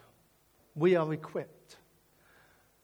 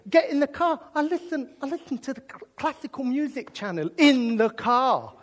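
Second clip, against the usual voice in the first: usual speaking voice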